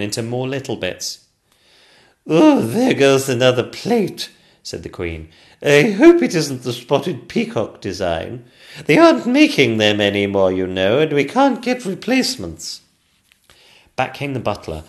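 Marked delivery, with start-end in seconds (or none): 2.3-4.3 loud, slow, fussy queen. Acted. Queen at dinner. 5.6-12.8 queen voice. Acted. Queen fussing.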